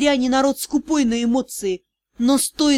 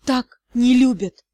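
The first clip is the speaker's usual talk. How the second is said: slow, moaning; moaned; 3 dB louder, slower than usual. A narrator's reading. Deya to Rian, slow and moaning. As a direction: groan the words out. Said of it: "простонала я".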